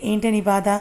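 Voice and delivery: ordinary delivery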